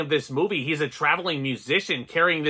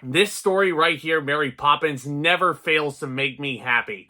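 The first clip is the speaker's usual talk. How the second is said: ordinary talk, nothing marked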